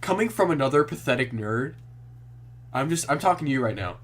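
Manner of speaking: normal talking voice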